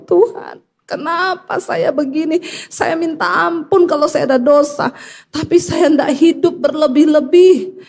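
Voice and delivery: high-pitched, re-enacted crying plea; imitated weeping prayer; tearful prayer. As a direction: beg God as if in tears. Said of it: "Saya nangis, saudara".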